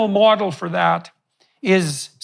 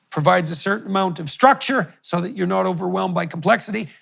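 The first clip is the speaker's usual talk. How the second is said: ordinary talk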